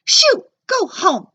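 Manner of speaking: high, loud, slow shoo; loud slow shooing yell. A narrator's reading. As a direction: drive the dog away. Lunch lady shoos dog.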